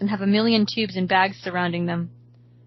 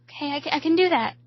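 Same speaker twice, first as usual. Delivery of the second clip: higher, hesitant voice; nervous agreement; 7 semitones higher than usual. A narrator's reading. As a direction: stammer a timid agreement, pitched up. Frightened friend answers in story.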